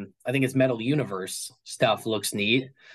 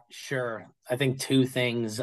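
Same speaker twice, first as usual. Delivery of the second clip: ordinary talk, nothing marked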